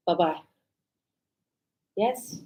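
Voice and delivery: ordinary delivery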